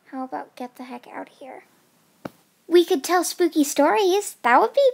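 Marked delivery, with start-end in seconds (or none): 0.1-1.6 very soft, quick grumble; muttered aside. Acted. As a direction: say it under your breath, fast. Aside so host won't hear.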